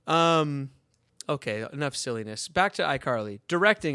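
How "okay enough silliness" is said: usual speaking voice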